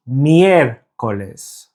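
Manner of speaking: "miércoles" slowly, leaning on "miér"; stress on first syllable. Shown as a demonstration. Teacher showing learners where the accent falls, "miércoles" stressed on "miér". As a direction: draw out "miér", then a lighter "coles". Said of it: "it's the first syllable that is the stressed syllable".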